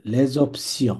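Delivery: Les options linked as le zopsio; French liaison. Shown as a demonstration. Teacher showing the liaison. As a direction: join the s of Les onto options. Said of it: "এটারে ধরবে".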